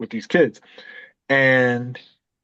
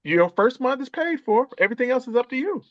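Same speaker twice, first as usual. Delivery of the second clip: a lightly voiced firm mother, pitched higher; mother matter-of-factly telling her son his time is up, lightly voiced higher; mother laying down law; 10 semitones higher than usual. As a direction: calmly explain the rules he didn't follow, as mom.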